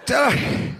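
high plea fading to slow gasp; plead, then drag the last words out breathless; strained plea. Retold or imitated. Uncle begging the kids, running out of breath.

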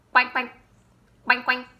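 loud playful duck quacks; loud "Quack, quack" duck call. Pretend. Teacher imitates duck for kids.

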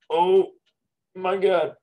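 high, loud oh my God; surprised. Real, not acted. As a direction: blurt it out in shock. Sneeze scare, near-sneeze exclaim.

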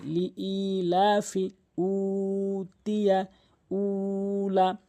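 slow recitation, holding "ই" and "লা" in "লি ই লা ফি"; drawn-out vowels. Shown as a demonstration. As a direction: stretch the "উ" of "উতিয়া" and "উলা" on a level note. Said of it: "এক আলিফ করে টেনে পড়তে হয়". ("উতিয়া", "উলা" সমান সুরে টেনে পড়া)

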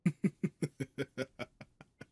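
high, loud demon laugh trailing away; long gloating cackle, falling off; villainous laugh. Acted. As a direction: burst out laughing, then let it fade. Demon laughs at own taunt.

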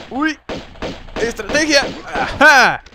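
high, loud gamer's cry; triumphant shout. Real, not acted. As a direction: crow it out mid-fight. Crowing mid-fight about his strategy. (grito triunfal)

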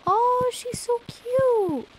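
very high, slow, drawn-out coo; gushing squeal. Real, not acted. Seeing Maru in the game, gushing squeal. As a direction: squeal it loudly over something adorable.

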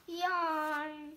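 usual speaking voice

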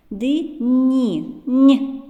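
"н" held long and hummed; stretched consonant. Shown as a demonstration. Teacher shows children the sound "н", drawn out. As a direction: pull out the "н" sound, isolating it. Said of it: "teacher isolates the sound Н".

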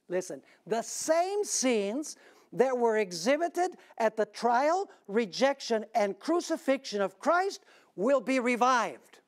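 rising preacher's fervour, slow and weighty; preaching. Acted. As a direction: drive the prophecy home from the pulpit. Preaching at full force.

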